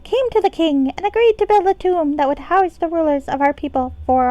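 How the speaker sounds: high-pitched pixie storyteller. Acted. Game player reading ancient tomb history to viewers, pixie voice. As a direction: read the chronicle in a high pixie voice. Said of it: "done with the pixie voice".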